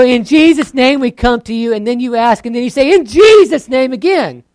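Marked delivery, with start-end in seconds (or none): 0.0-1.7 loud, high opening prayer; mocking a doubled Jesus name. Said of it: "you do it twice". 2.9-3.9 loud, higher, slow; mocking a doubled Jesus name, loud; emphatic closing. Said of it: "you do it twice".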